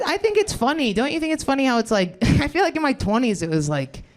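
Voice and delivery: usual speaking voice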